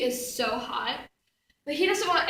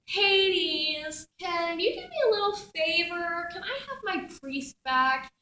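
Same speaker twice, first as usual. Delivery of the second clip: drawn-out, coaxing little plea; butter him up for a favor; wheedling request; 3 semitones higher, slower than usual. Retold or imitated. Asking Hades to return her priest, wheedling. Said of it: "I'm like".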